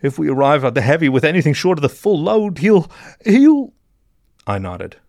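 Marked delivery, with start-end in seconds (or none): 0.0-3.7 high voice climbing into panic; panicked accomplice stammering to a halt; frightened mole in blue. A narrator's reading. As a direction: let dread build until the words fail, getting louder.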